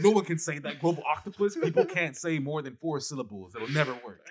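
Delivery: high, mocking voice of doubting friends; mocking doubters. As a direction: squeak it out like a snooty know-it-all. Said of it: "They all clowned me for it".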